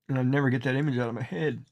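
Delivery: ordinary delivery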